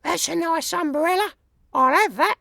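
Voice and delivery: very high, sing-song woman thief; a greedy lady coveting loot; burglar lady voice. A narrator's reading. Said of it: "and a voice says".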